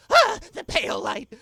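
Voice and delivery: very high, loud panicked shriek; old man shriek. Acted. Old man wakes to pounding at the door, shrieking. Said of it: "a old man shriek".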